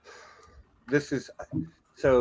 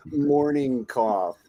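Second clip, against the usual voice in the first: normal talking voice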